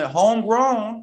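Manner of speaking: Homegrown stretched slow and high; savoring a word. Real, not acted. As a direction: draw out Homegrown, lifted high. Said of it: "I'm gonna say homegrown now".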